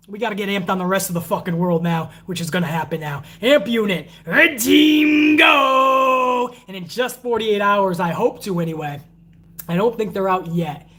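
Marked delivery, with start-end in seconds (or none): 3.4-6.5 long, drawn-out high battle cry; catchphrase shout. Real, not acted. Channel catchphrase sign-off.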